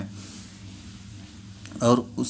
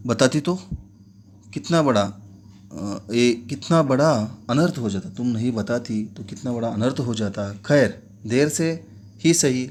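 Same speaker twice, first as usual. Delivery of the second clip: ordinary delivery, nothing marked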